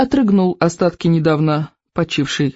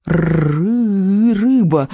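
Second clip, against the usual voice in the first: stammering little dragon, a touch higher; squeaky dragon whelp; 4 semitones higher, much slower than usual. A narrator's reading. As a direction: squeak out the stammered words like a hungry baby dragon. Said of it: "пищал Беззубик". (пищащий дракончик)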